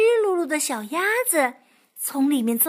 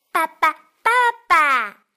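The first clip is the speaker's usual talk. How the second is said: excited duckling; 2 semitones higher, 8 dB louder than usual. Acted. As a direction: squeal it out with joy. Duckling calling.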